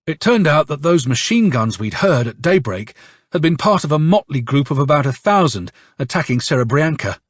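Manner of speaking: ordinary delivery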